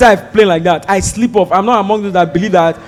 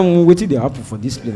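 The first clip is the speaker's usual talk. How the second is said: Pidgin grumble; 8 semitones lower, 7 dB quieter than usual. Retold or imitated. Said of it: "We all be here".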